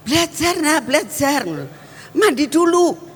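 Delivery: put-on high, insistent mom ordering the kids; nagging mother. Mother hurrying her children to study and bathe, high and nagging.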